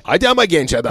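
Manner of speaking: dubbed-film reproach. Shown as a demonstration. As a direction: chide a friend like a dubbed movie character. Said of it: "bir ufak bir serzenişi". (tiz perdeden dublaj serzenişi)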